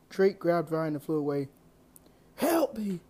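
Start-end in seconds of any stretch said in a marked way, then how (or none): 2.3-3.0 high, loud pained cry for help. A narrator's reading. Wounded hero crying out for help, high pained cry. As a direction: cry out as you hit the ground hurting. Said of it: "I cried out as I fell".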